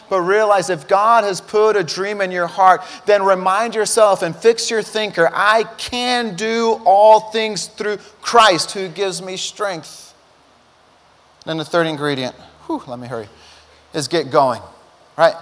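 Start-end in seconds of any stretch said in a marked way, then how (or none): 5.3-10.1 slow declaration. Shown as a demonstration. Quoting scripture slowly.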